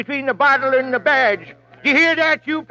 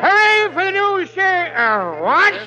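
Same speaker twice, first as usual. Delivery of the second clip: put-on town drunk; 4 semitones higher than usual. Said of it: "The town drunk?".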